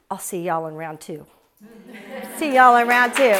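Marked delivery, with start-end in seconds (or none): 2.4-3.3 louder repeat. Real, not acted. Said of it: "all I wanna say to you guys".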